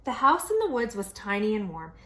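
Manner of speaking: usual speaking voice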